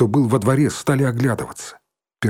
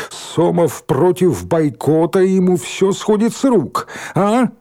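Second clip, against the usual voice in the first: high, louder, drawn-out accusation; ringing indignant cry, pitched up; relentless rabble-rousing; 7 semitones higher, 3 dB louder, slower than usual. A narrator's reading. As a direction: rouse the crowd to a chant. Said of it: "не унимался Валька".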